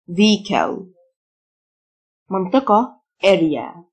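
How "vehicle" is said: vehicle said "VEE-ikl", without the H; silent h. Shown as a demonstration. Teacher showing learners a silent letter, no h sound in vehicle. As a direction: say vehicle, dropping the h. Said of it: "ال H silent".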